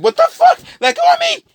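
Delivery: very high, loud terrified yelling; panicked scream. Retold or imitated. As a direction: scream in panic as you're grabbed. Victims screaming while grabbed.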